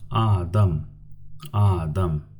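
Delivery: adam said clearly twice; careful teacher model of adam; model word. Shown as a demonstration. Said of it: "The second word".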